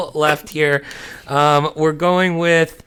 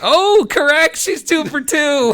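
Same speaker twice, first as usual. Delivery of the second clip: high, thrilled game-show host; excited announcement; 11 semitones higher than usual. Real, not acted. Host cheers a contestant's streak, excited announcement. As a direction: call out the score in high-pitched delight.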